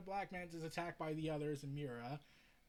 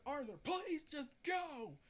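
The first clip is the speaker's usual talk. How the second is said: pleading shout; 9 semitones higher, 3 dB louder, slower than usual. Acted. Begging Arthur to leave.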